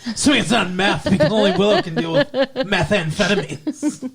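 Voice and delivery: talking through real laughter; laughing. Real, not acted. Riffing on the co-host's slip, laughing. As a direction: crack up while joking, voice lifting. Joking about a misheard word.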